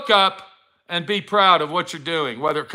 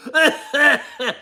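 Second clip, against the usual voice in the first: laugh; 8 semitones higher than usual. Real, not acted. Laughing at own impression.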